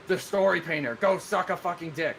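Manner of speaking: acted-out taunt; mock insult. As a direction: hurl a pretend insult as an example. Acts out example chat insult.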